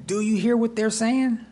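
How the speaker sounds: high-pitched affronted question; indignant challenge. Acted. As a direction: demand it, scandalized. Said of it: "the tone in their voice".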